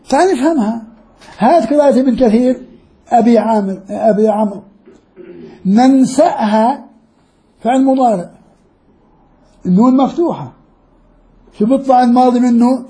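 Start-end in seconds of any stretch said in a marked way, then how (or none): 5.7-6.8 ننسأها slow, glottal stop clear; with the hamza. Shown as a demonstration. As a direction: drag out ننسأها, hit the hamza. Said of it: "بالهمزة".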